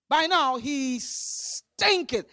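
"stinketh" stretched long and slow; drawn-out verdict. Retold or imitated. Preacher repeating Martha's warning, "stinketh" slowly drawn out. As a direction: relish a long hiss opening "stinketh".

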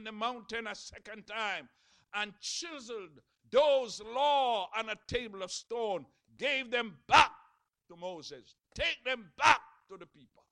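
loud, high, rising sermon cadence; preaching at full force. Acted. Preacher to his congregation, loud and fervent. Preacher telling Moses story.

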